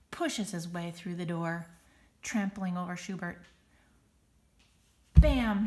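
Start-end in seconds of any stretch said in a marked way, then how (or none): 5.2-5.7 booming sound word; big crash word; loud bam. A narrator's reading. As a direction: slam it out loud. Sound effect word in story.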